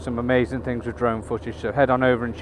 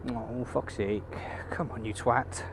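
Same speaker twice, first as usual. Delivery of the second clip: much softer, swearing under the breath at a driver; muttered road rage; 12 dB quieter than usual. Real, not acted. Swearing under his breath at another driver, muttered angry.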